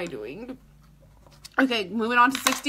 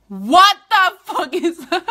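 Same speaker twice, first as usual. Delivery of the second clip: louder, drawn-out disbelief; shocked; 5 semitones higher, 10 dB louder, slower than usual. Real, not acted. Catching a smell, she blurts out shocked.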